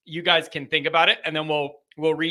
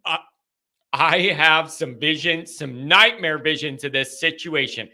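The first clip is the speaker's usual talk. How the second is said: ordinary delivery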